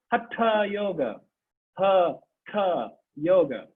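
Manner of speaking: "Hatha yoga" slowly, the t curled back; "Hatha" with a retroflex t, unhurried; tongue curled back on the t of "Hatha". Shown as a demonstration. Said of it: "spelled H A T H A with a dot on it".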